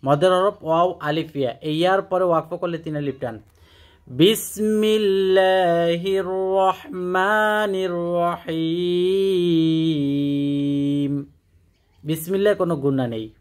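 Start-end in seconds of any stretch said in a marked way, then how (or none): 4.1-11.2 slow, drawn-out chanted recitation; Quran recitation. Shown as a demonstration. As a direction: recite with long melodic stretches. Model recitation after explanation. (ধীর সুরেলা কুরআন তিলাওয়াত)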